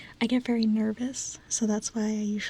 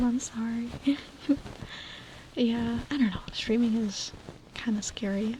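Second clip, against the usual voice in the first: ordinary delivery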